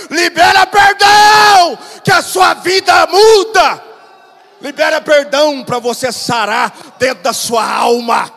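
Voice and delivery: impassioned preacher building to shouts; rousing sermon cry; full-force preaching. Real, not acted. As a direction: preach it loud, stretching the key phrases.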